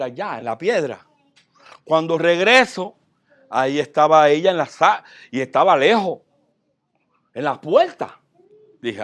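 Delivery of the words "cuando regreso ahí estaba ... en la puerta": louder, rising with excitement; excited telling. Retold or imitated. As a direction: tell it loud, amazed she came back. Surprised to find the dove back.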